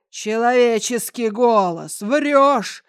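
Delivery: loud, slow accusing giant. Acted. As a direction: boom out the accusation.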